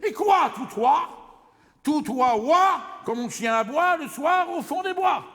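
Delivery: high, loud, triumphant nonsense; a loud, manic burst of comic punning; shouted wordplay. Acted.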